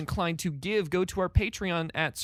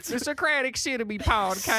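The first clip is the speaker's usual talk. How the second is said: high laughing cowboy twang; drunk cowboy voice; 6 semitones higher than usual. Pretend. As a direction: repeat it as a tipsy cowboy. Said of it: "I sound like the drunkest cowboy".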